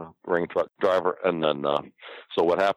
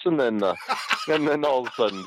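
high, breaking up with laughter; laughing while talking; 8 semitones higher than usual. Real, not acted. Storyteller cracking up after his own impression, laughing. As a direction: carry on through the giggles.

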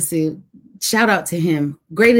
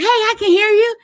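rescuer calling down; 14 semitones higher, 4 dB louder than usual. Retold or imitated. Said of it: "And somebody says".

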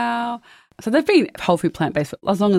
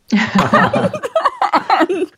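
high burst of laughter; 13 semitones higher than usual. Real, not acted. Laughs at son's hot dog line.

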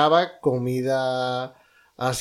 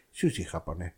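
lower, quiet, hurt correction; offended shopkeeper; 7 semitones lower, 7 dB quieter than usual. Retold or imitated. The shopkeeper, offended, correcting him in a low voice. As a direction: mutter it, wounded.

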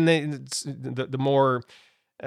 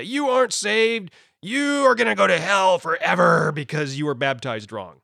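mock condemnation; 2 semitones higher than usual. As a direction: imitate a preacher damning someone. Mimics people condemning others to hell.

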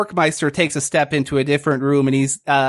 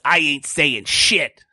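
tough frog suspect; slower than usual. Acted. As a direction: sneer it at the cop. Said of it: "and he says".

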